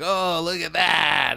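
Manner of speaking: high, slow amazed onlooker voice; drawn-out gawking "oh, look at that"; gawking zoo visitor. Retold or imitated. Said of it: "the people are all like".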